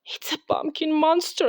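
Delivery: a touch of high-pitched fright; frightened little unicorn thinking aloud; mild fright; scared thought. A narrator's reading. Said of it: "he thought".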